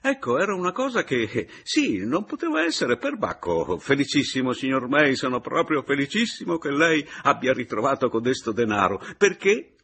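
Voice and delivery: loud, high, effusive sputtering; flustered babbling. A narrator's reading. Paleari congratulating signor Meis, flustered. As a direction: gush on, flustered and muddled. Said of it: "balbettò il Paleari".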